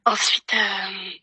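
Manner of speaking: usual speaking voice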